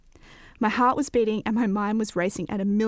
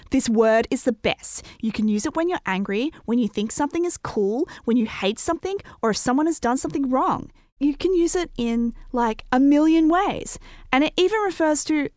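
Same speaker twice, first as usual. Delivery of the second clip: building higher and louder as the list grows; excited gushing; 5 semitones higher, 4 dB louder than usual. A narrator's reading. Girl praises new word to friend, excitedly gushing. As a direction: gush like an excited ten-year-old, gathering steam.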